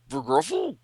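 very high garbled word through a full mouth; mouth stuffed. Acted. Duplicate Boy mumbles a question with ring in his mouth. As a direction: try to talk around a ring in your mouth. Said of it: "she shoves it in his mouth".

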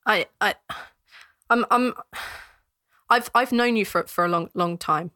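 faltering, pleading guard; stammering guard. Acted. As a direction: struggle to get the words out.

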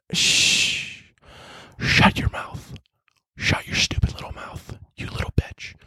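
hissed whisper of a threat; menacing whisper. Acted. Threatening Simone's online critics.

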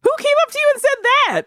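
very high-pitched disbelief; incredulous. Real, not acted. Co-host asking who said that to friend, incredulous. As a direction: demand it in a high, astonished voice. Shocked reaction to friend's story.

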